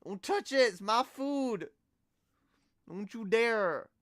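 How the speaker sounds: high, loud yelling cat guarding its food; possessive cat voice. Acted. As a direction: shout like a greedy cat defending its dinner.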